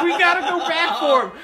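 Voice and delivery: high, loud, laughing; laughing mock panic. Narrator urging a return for the fallen friend, laughing high cry. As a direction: cry out through laughter to go back.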